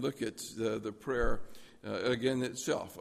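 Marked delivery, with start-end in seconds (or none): none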